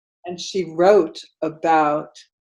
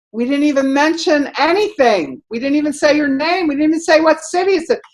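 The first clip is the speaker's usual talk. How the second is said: protesting plea; 10 semitones higher, 4 dB louder than usual. Retold or imitated. Pleading with angry cook.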